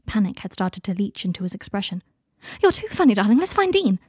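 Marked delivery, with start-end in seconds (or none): 2.6-4.0 loud, high, panicky brightness; high-pitched false gaiety. Acted. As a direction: pitch it high, forcing the fun. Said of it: "Draco's high-pitched laughter".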